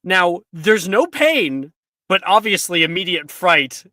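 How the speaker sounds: slow, loud, spooky storytelling; dramatic narration. A narrator's reading. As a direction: build dread like a campfire tale.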